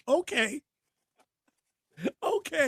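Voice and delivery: a mock-resigned okay; sarcastic okay. Real, not acted. Sarcastic reaction after rant.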